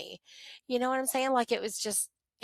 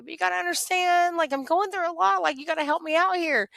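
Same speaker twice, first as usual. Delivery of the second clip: higher, louder pleading; pleading with God; 9 semitones higher, 4 dB louder than usual. Retold or imitated. Grieving daughter begging God for help, high pleading. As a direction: beg God for a break, voice lifted.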